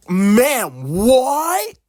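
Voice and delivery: loud, rising disbelief; incredulous outburst. Acted. Student reacting to French teacher's whispered remark, loudly incredulous. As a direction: pitch leaps up on a drawn-out, stunned question.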